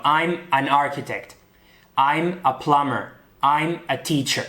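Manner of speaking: slow, careful English sentences; English example sentences. Shown as a demonstration. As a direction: model each sentence slowly for learners. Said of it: "Así que diremos, por ejemplo". (frases de ejemplo en inglés, lentas y claras)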